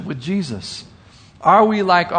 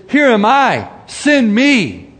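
higher and louder, bright and ready; eager volunteering; 6 semitones higher, 5 dB louder, slower than usual. Acted. Isaiah answers God, eagerly volunteering, high and loud. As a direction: step forward and offer yourself.

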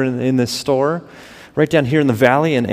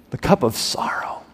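the cup of sorrow whispered; 4 dB quieter than usual. Acted. Preacher describing the cup of wrath, whispered. Dramatic scripture build-up.

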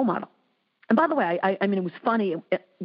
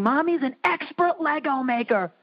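high-pitched imitation of a child; mock childlike admiration; impressed little daughter; 8 semitones higher than usual. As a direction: voice a proud little kid, pitched up. Voices impressed daughter's praise.

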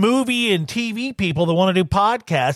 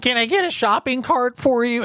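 put-on high, sweet little old lady; 7 semitones higher than usual. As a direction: offer help like a kindly grandma. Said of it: "I'm doing an old lady voice".

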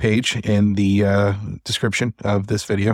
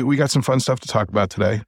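normal talking voice

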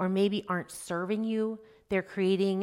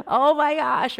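exasperated; 7 semitones higher, 9 dB louder, slower than usual. Retold or imitated. Mom exasperated by kids.

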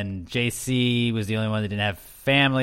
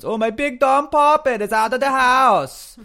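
Tommy Wiseau impression; 12 semitones higher, 7 dB louder than usual. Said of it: "That was more of a Tommy Wiseau".

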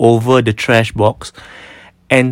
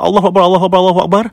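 higher, urgent exclamations of fear; a frantic, higher Allahu Akbar; panicked prayer; 7 semitones higher, much faster than usual. Retold or imitated. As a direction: cry out to God in a panic. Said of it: "she said, she said".

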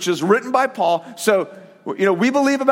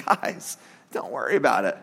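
gentle reassurance; 9 semitones lower, 6 dB quieter than usual. Pretend. God soothing anxious people.

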